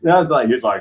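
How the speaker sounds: usual speaking voice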